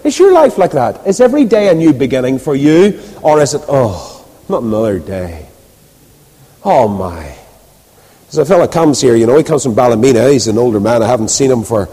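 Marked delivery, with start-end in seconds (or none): 3.7-7.3 low, soft weary groan of words; weary dread. Acted. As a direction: sigh it out low and quiet, fed up. Acting out dread of another day.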